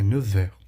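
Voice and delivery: "neuf heures" with a closed, rounded eu; closed French vowel. Shown as a demonstration. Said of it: "Mais fechadinho".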